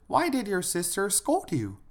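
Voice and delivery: put-on high-pitched mother asking her little girl; mother questioning. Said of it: "she asked the younger sister".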